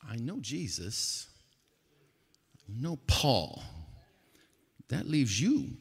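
lightly voiced demon, taking its time; understated demon retort with deliberate pauses; talking demon. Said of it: "and the demon talks back".

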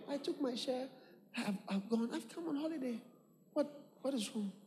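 soft mock innocence. Mocking the unrepentant son.

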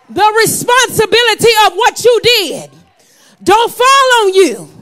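loud, high, slowing on the end; preacher raising the refrain to a shout; preached shout. Acted. Preaching refrain, louder take.

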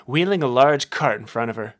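ordinary delivery

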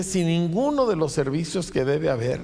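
ordinary talk, nothing marked

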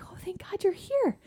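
whispered in relief; relieved whisper. Retold or imitated. Stranded cyclist thanking the repair guy, relieved whisper. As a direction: breathe it out with relief.

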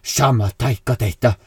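mock Sami film line, loud and drawn out; slow, loud gibberish imitating Sami dialogue; liksom-samisk filmreplikk. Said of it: "Nå la jeg på dubben oppå". (tulleete etterligning av samisk replikk)

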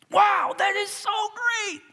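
a friend hearing your good news, thrilled; excited whisper-shout. Retold or imitated. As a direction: gasp it out, overjoyed. Celebrator voiced.

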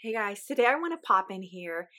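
ordinary talk

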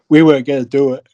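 words stretched through a yawn; a real yawn swallowing the line. Real, not acted. Tired speaker yawns mid-story about long drive. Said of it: "sorry, I'm yawning".

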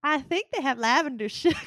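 teasing Midwest accent imitation, laughing. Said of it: "I just said that with a Midwest accent".

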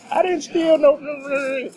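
very high-pitched mock outrage; loud, belligerent mimicry. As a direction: mimic an indignant customer way up high. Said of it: "starts getting loud and belligerent".

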